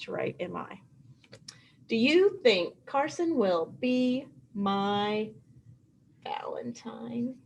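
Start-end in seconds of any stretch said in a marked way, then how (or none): 1.9-5.3 words stretched slowly for writing; slow, careful dictation. Shown as a demonstration. Teacher writing the question out for first graders, slow. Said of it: "I'm gonna write it down here". 6.2-7.4 soft unvoiced whisper. Teacher whispering the last word to her class.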